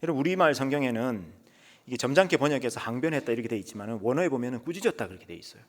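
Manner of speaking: normal talking voice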